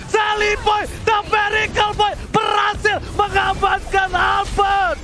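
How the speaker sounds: high, drawn-out shouted hype call. Real, not acted. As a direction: scream the hero's name to the crowd. Caster hypes clutch play.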